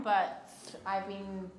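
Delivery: ordinary talk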